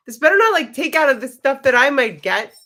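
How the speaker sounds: high, loud retold objection; hammed-up indignant worry; mock protest. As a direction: blurt it high and loud, half-joking. Retells her mock worried protest.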